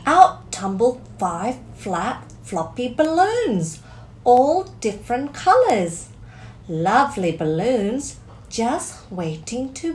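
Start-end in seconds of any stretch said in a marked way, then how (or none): none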